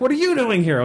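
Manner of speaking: high mock indignant question; mock challenge. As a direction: toss the question back indignantly. Orc and players exchange challenge.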